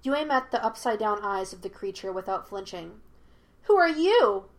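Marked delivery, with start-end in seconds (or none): 3.6-4.4 high, loud monkey voice; demanding. A narrator's reading. Monkey spirit confronts Yue, demanding. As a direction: demand an answer loudly. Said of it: "he demanded".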